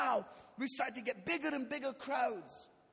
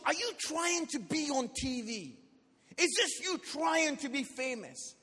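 acted scolding, louder accusing questions; stern rebuke; 3 semitones higher, 5 dB louder than usual. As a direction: imitate an appalled church leader telling someone off. Church leader scolds him after show.

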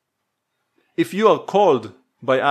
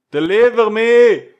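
loud, slow, higher plea; fervent cry; 7 semitones higher, 6 dB louder than usual. Acted. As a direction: cry out for rescue. Climax of the voiced prayer.

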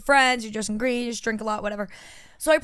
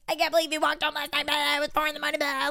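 mock nagging mom; 3 semitones higher, faster than usual. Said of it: "like my mom".

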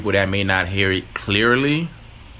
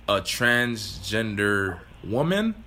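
careful wording; much slower than usual. Real, not acted. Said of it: "Is that the correct term?".

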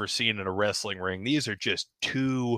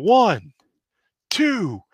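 much higher, louder, excited count; excited pin count; 6 semitones higher, 4 dB louder, slower than usual. Acted. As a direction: call the count like live commentary. Live pin count commentary.

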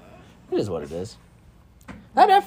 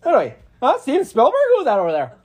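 loud, higher mock-incredulous rant; Chris Farley; 9 semitones higher, 5 dB louder, faster than usual. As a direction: bluster like a sketch comic. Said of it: "a skit on Saturday Night Live featuring the great Chris Farley".

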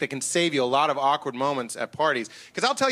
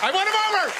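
high gleeful boast; triumphant; 13 semitones higher than usual. Acted. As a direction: crow it like a victory. Triumphant boast after crowd bit.

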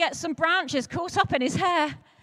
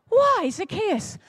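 indignant crowd; 6 semitones higher, 3 dB louder, slower than usual. Acted. Crowd gasps, asks.

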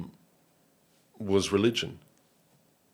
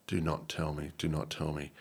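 muttered disbelief; 5 semitones lower, 9 dB quieter than usual. Retold or imitated. Dawning realisation, muttered.

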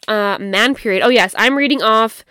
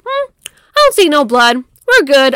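mock dismissive guy; 5 semitones higher, 7 dB louder than usual. Guy checking, acted incredulous quote.

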